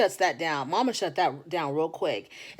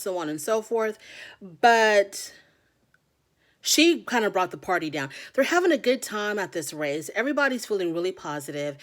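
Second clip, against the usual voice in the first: ordinary delivery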